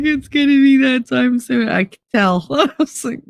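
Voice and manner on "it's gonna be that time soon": knowing warning. Retold or imitated.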